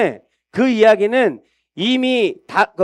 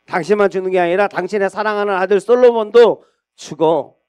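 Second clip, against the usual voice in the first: ordinary talk, nothing marked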